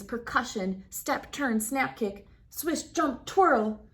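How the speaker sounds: ordinary delivery, nothing marked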